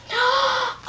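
high, sharp gasp of delight; thrilled gasp. Acted. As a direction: gasp at winning. Winner reacts to being chosen.

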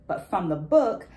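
ordinary talk, nothing marked

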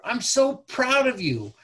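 warm proud praise. Shown as a demonstration. Demonstrating enthusiastic praise to kid.

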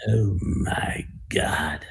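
slow, hushed, horrified Charlton Heston gasp; Charlton Heston impression. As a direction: whisper "Oh my God" slowly like Heston seeing something horrible. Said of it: "it was a Charlton Heston".

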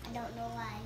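quiet mumble. Real, not acted. About to eat jelly beans.

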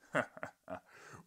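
big booming laugh; bellowing laugh. Acted. Professor at the visitor, a big booming laugh. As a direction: roar with laughter. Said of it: "He bellowed with laughter".

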